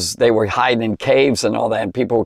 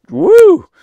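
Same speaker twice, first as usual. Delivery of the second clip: a sudden soaring woo, much louder; shrill preacher's whoop of awe; high loud whoop; 8 dB louder than usual. Real, not acted. Exclaiming over Jesus as Judge.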